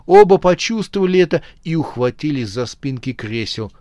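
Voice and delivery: usual speaking voice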